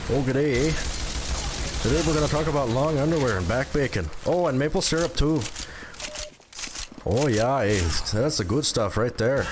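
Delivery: put-on Canadian accent; Canada voice. Streamer redoing the Canada voice on request, Canadian accent. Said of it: "Do the Canada voice again?".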